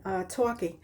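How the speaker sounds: usual speaking voice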